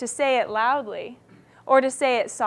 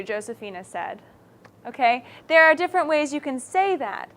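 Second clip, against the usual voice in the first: ordinary talk, nothing marked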